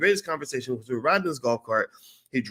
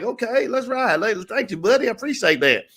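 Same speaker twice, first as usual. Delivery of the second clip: put-on Kentucky twang, pitched much higher; Kentucky lingo; 11 semitones higher than usual. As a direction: play up a folksy Kentucky accent to fit in. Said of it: "'cause Kentucky, you gotta, like, speak the lingo".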